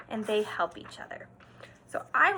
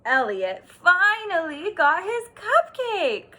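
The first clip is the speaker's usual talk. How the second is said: slow, loud and higher celebration; triumphant; 6 semitones higher, 10 dB louder, slower than usual. A narrator's reading. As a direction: announce the win slowly and big. Triumphant story moment.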